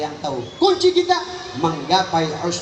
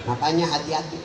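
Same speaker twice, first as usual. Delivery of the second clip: ordinary delivery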